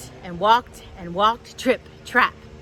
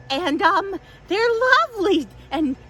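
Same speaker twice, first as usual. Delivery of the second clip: high goat voice; 9 semitones higher than usual. Acted. Tilly pleads.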